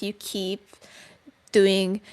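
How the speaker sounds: ordinary delivery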